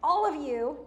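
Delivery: loud, pointed "all of you"; emphatic address. Retold or imitated. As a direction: stress "all of you" to take in everyone. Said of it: "he emphasizes it".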